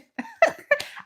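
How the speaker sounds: a sudden high burst of laughter; quick amused cackle. Real, not acted. Laughing at their imagined outrage.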